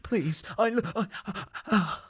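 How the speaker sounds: hammed-up expiring, words failing; dying gasps. Stabbed clacker's final words, faltering mock death gasps. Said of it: "he just gets stabbed".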